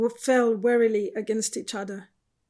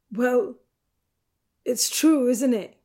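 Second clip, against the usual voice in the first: angry retort; 3 semitones higher, 6 dB louder than usual. A narrator's reading. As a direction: push back with hurt insistence. Angry retort through tears.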